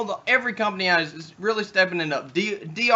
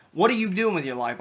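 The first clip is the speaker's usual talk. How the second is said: normal talking voice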